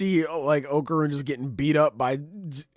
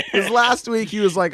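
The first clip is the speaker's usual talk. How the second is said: usual speaking voice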